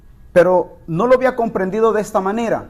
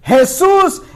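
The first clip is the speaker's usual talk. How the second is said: crying out; 6 semitones higher, much slower than usual. Retold or imitated. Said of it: "Qué es lo que está exclamando él".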